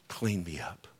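low, soft, breath-led prayer; hushed plea. Acted. Preacher models coming to Christ to be cleansed, hushed plea. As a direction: plead it almost under the breath.

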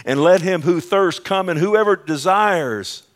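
high, loud preacher's cry; full-force proclaiming. Acted. Preacher reads out Revelation's invitation, proclaiming loudly.

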